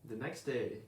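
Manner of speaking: plain reading voice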